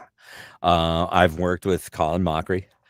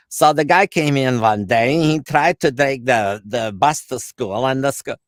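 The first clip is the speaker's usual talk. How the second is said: loud, slow, high Polish accent; hammed-up audition in a thick Polish accent; 8 semitones higher, 8 dB louder, slower than usual. Shown as a demonstration. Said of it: "they're looking for a Polish accent".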